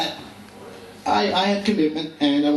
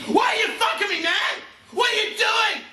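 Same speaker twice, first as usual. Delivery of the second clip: high pained yell at a pillow; retold drunk scream. Drunk, screaming at a pillow, high yell. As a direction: howl it like a drunk at his pillow. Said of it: "I'm screaming".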